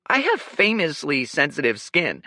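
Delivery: high, loud whiny protest; cried. A narrator's reading. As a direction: wail it like a pampered noble. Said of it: "cried Leo".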